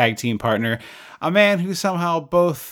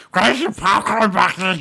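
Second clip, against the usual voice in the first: frantic customer; 6 semitones higher, slower than usual. Retold or imitated. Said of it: "foaming at the mouth maniacs coming in".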